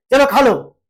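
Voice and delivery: grudging command to eat; annoyed offer. Shown as a demonstration. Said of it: "इसको गुस्सा आ रहा है".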